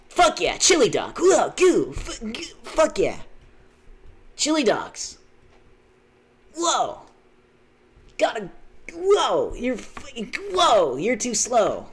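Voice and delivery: high, loud hammed-up cartoon yelps; Sonic. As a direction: blurt it in bursts, pitch swooping down. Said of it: "Were you Sonic?".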